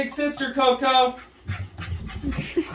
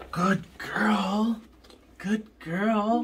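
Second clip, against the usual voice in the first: whispered; 6 semitones higher, 6 dB quieter, much slower than usual. Shown as a demonstration. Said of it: "Good girl (plain take before)".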